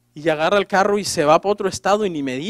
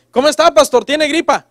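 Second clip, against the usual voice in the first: higher, louder mock caller; concerned caller; 5 semitones higher, 5 dB louder than usual. Church member phones the pastor about his cold, calling out.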